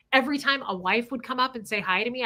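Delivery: normal talking voice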